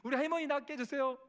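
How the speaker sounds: put-on high, loud child's prayer; shouted prayer. Children pray loudly for their sick family, high and shouted. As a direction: cry out each plea for healing at the top of the voice. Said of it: "외치고 기도하는데".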